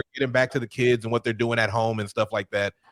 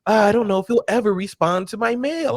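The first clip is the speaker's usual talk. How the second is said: wistful Marsha; 8 semitones higher than usual. Retold or imitated. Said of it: "she was like".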